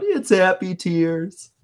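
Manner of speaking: imitating a tearful kid, high and slow; crying little boy. Podcaster mimicking the boy answering his sister, mock-tearful kid voice. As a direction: put on a young boy's high, drawn-out crying voice. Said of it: "he just starts crying".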